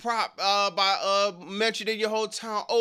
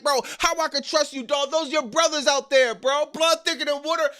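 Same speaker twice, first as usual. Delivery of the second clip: higher, fast, ending in a shout; pretend fired-up wrestler; 6 semitones higher than usual. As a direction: pitch the promo you'd want with real vitriol. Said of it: "have L A Knight be pointing at".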